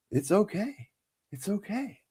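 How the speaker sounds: high, soft mock-soothing voice. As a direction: coo it like calming a child. Acupuncturist soothing him.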